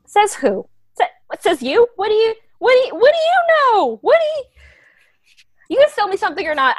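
rising, frantic high voice; panicked protest. Acted. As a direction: push back in rising panic.

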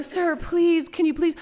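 high, urgent mock plea; pleading caller. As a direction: imitate a flustered caller begging. Retold caller pleading with angry cop.

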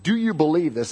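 ordinary talk

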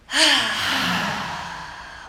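long audible exhale sigh. Shown as a demonstration. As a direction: let the breath out with a sigh. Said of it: "Let's try it again".

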